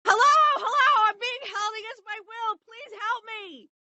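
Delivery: high desperate cry for help; yelling for help. Acted. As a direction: call out for rescue, frantic. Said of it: "I'm gonna yell".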